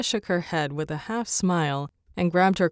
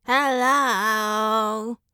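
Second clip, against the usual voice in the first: a parrot's shrill, slightly higher squawked hello; screeching parrot; 4 semitones higher than usual. A narrator's reading. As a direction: squawk it like a broken doorbell. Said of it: "loud, screeching".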